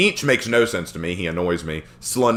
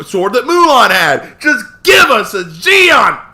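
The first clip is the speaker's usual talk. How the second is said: higher, louder desperate pleading to shouting; 10 semitones higher, 6 dB louder than usual. Real, not acted. Begging Ubisoft for a Wu Lin Xion sword, loud and pleading. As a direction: beg Ubisoft at the top of your voice.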